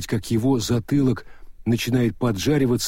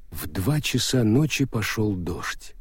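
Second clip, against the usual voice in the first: usual speaking voice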